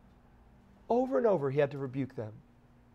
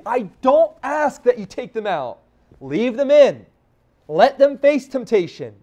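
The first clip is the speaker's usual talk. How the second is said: higher and louder, ringing out; pleading, higher and louder preacher's cry; fervent preaching; 6 semitones higher, 12 dB louder than usual. Acted. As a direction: drive it home to the church. Preaching Christ's words, rising.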